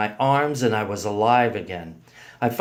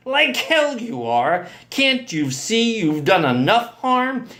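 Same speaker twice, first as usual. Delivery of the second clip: high, indignant outburst; incredulous, angry retort; outraged disbelief; 8 semitones higher, 3 dB louder, slower than usual. A narrator's reading. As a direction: challenge him in raised disbelief. Uncle outraged at boyfriend's refusal.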